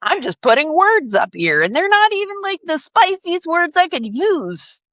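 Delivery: high-pitched, hammed-up indignation; indignant protest. Creator protesting flagged posts to moderators, mock indignant. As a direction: plead innocence, pitch climbing high.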